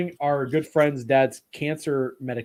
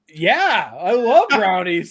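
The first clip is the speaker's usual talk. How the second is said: mock eager; 4 semitones higher, 7 dB louder, much slower than usual. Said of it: "my fat ass goes".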